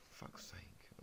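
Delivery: whispered curse under breath; exasperated whisper. Real, not acted. Reading aloud, he stumbles and whispers in frustration. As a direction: hiss it to yourself, fed up. Annoyed at misreading.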